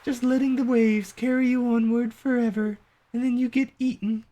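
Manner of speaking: light, high, dreamy villager; high character voice. Acted. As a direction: voice a carefree townsperson, up high.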